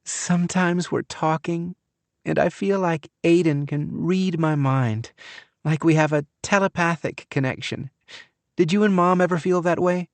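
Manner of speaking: high, lilting, dreamy sing-song. A narrator's reading. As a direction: gush it like a starry-eyed girl. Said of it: "in a sing-song voice".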